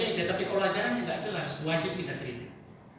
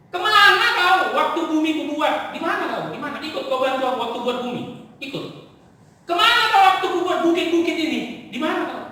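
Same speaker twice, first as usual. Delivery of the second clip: thundering God; 6 semitones higher, 6 dB louder than usual. Retold or imitated. God answers complaining Job with a booming challenge. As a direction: demand it like God rebuking a small man. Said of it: "Apa dia bilang Tuhan?".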